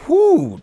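high, loud whew of relief; relieved whew. Real, not acted. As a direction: let out a big whew after a mouthful. Relief after a long reading.

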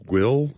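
"Will" drawn out slowly; pronunciation guess. Shown as a demonstration. Answering how to pronounce the road name, slow "Will". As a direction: say "Will" slowly as a tentative reading. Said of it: "How would you pronounce it".

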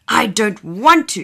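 a sulky sniffly no; sniffing. Acted. Big dinosaur refusing the teacher, sniffly and sulky. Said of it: "he sniffed".

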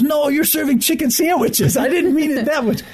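very high-pitched, hammed-up protest; mock exasperation. Hotelier protesting to a chicken sandwich seller, high mock exasperation. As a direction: squeal out a comic, exasperated objection.

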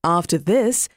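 ordinary delivery, nothing marked

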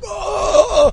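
sudden sharp cry of pain; pained yell. Retold or imitated. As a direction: cry out as the back gives way. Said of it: "I was like".

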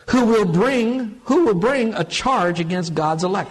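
high, loud, slow rhetorical question; fervent sermon question; impassioned preaching. Acted. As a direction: challenge the room with it. Preaching the verse.

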